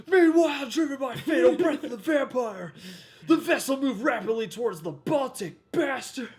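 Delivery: high, loud dramatic narration; 1950s narrator. Pretend. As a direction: read it like a breathless newsreel. Reading intertitle in mock dramatic voice.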